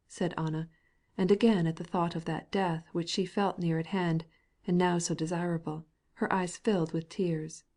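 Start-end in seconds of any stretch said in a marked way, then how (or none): none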